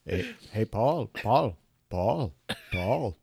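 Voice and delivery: pestering, badly faked British accent; mock British drummer nagging his bandmate. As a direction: keep calling in a dopey Liverpool voice. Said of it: "so I could be [name]".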